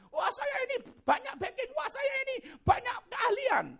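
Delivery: very high, loud crowing; mock boasting. Preacher mocking people who brag of connections and skills, boastful. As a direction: crow like a hammed-up show-off. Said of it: "Kok malah dibanggakan?".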